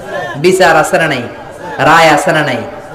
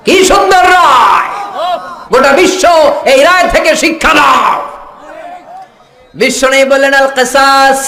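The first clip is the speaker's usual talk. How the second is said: ecstatic preaching shout; 9 semitones higher than usual. Acted. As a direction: roar it out in rapture. Repeats the phrase then shouts.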